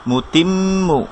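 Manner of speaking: slow মুতিম্মু, mm held in the nose; মুতিম্মু with long nasal mm; held ghunna. Shown as a demonstration. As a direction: hum the doubled m of মুতিম্মু slowly. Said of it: "এখানে আমরা কেন একটু ধরছি".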